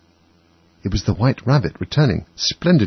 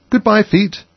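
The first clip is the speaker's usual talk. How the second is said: high, loud farewell to her feet; calling goodbye; 12 semitones higher, 4 dB louder, slower than usual. A narrator's reading. Alice calls down to her vanishing feet, high and loud. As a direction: wave off her distant feet with a high cry.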